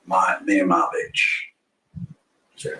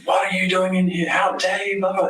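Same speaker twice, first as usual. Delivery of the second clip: mock yell, much higher; retold scream; 9 semitones higher than usual. Neighbour catching him in the room, mock high yell. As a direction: imitate an angry neighbour yelling, pitched up. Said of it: "he's screaming".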